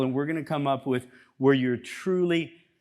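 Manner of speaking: ordinary talk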